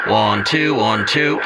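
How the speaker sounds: loud, clipped counting into the mic; mic check. Real, not acted. Testing the fixed Jackson mic with a loud count. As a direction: call the numbers out to test the level. Testing the thumb wheel setting.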